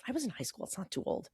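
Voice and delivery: soft, half-whispered reasoning; hushed aside; quiet self-reassurance. Retold or imitated. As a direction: mutter it to yourself under your breath. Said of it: "self-talk".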